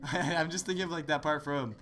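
usual speaking voice

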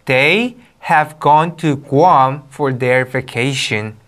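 very slow English read-aloud; drawn-out, careful teacher's reading; model reading. Shown as a demonstration. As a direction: read it out word by word for students. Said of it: "자, 읽어볼게요".

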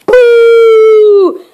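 loud boo from the bed; sleepy voice boo. Acted. Mister Lazy shouting boo from under the bed covers. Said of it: "at the top of his sleepy voice".

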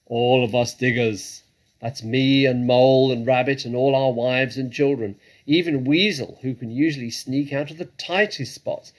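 loud, agitated character voice; worked-up, booming badger in a panic; frantic badger. A narrator's reading. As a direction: rattle off the plight loudly, ending high and desperate. Badger's frantic account continues.

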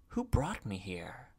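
soft, hushed question; subdued question. A narrator's reading. Unc to the man in charge, soft and subdued. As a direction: ask it quietly, with little force.

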